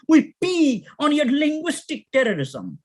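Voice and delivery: high, defiant declamation; sister's scream. Acted. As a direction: cry out the protest like a poet-activist. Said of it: "screams our sister Anzaldúa".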